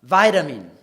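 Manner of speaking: American "vitamin" as "VY-duh-min", loud; emphatic American demo of "vitamin"; American accent. Shown as a demonstration. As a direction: say "vitamin" loudly with long I and tapped American T. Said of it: "the Americans will say".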